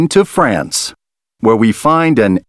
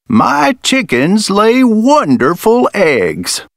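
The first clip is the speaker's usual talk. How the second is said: lightly voiced jolly farmer, higher, slow; a hint of a proud old farmer; 8 semitones higher, slower than usual. A narrator's reading. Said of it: "says the farmer".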